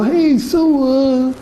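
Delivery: fake-friendly drawn-out small talk; hammed-up phony caller. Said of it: "like I was doing an example earlier".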